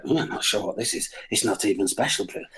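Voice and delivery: unvoiced, puzzled murmur; whispering bladder. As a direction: voice a confused bladder. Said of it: "my bladder was like".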